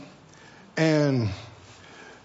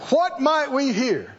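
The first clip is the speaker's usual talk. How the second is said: higher, probing preacher's questions with long pauses; preaching; 9 semitones higher than usual. Acted. Preacher quizzing the church about their houses, probing. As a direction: tease the congregation about their homes.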